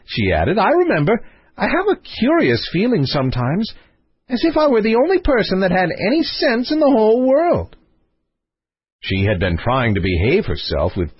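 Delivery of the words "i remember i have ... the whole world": high-pitched, whimsical princess; playful princess. Acted. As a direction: voice a princess airily musing, up high. Princess character in story.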